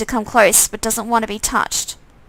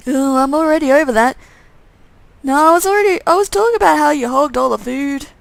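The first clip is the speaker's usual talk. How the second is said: whiny undead twin; 4 semitones higher than usual. Acted. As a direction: gripe about the food. Twin complains.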